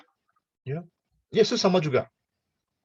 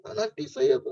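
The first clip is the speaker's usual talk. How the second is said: usual speaking voice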